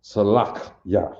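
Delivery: Salak ya said slowly, ending on ya; teacher repeating the right form; correct pronunciation. Shown as a demonstration. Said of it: "That's not pronounced E".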